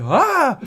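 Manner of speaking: high, short mock scream; sudden high-pitched "ah" of alarm; imitated startled scream. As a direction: act out waking with a fright. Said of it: "and I'd be like".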